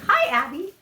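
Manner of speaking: high, loud little parrot voice; imitating parrots. Green cheeks repeating what Abby taught them, high imitated parrot voice. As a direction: squeak it out like a talking bird. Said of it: "taught the other green cheeks to say".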